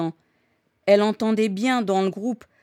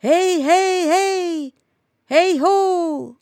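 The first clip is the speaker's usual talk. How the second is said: high, loud drawn-out hollers; echoing calls; 9 semitones higher, 6 dB louder, much slower than usual. A narrator's reading. Echo answering from the burning village, loud high calls. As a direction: shout back like a mountain echo. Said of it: "Et l'écho répéta".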